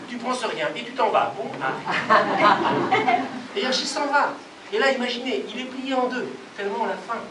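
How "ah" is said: usual speaking voice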